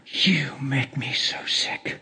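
whispered, slow and soft; imitating the wife's cold hiss; hissed contempt. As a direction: hiss it with disgust. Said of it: "and she said".

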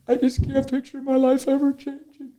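high-pitched imitation of tearful wailing; crying. Crying person answering the minister, mock tearful. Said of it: "Why are you crying so hard".

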